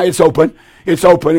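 normal talking voice

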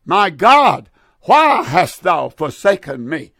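loud, anguished calling out to God; the Son calling out from the cross, loud and anguished; anguished cry. Acted. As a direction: cry it out, forsaken and pleading. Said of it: "the Son cried out".

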